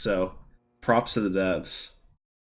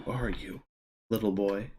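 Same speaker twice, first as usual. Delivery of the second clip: creepy quiet echo; 7 dB quieter than usual. Retold or imitated. Echoes creepy game voice.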